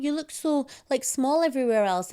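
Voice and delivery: high mock cooing voice; mock reassuring. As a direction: imitate everyone cooing at her. Mimicking people's reassurances.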